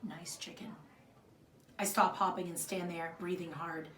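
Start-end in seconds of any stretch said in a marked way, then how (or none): 0.0-0.7 soft, low, almost under the breath; quiet remark. Acted. The little boy looks back at the girl and quietly says it, low and soft. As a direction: say it quietly over the shoulder. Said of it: "quietly says two words".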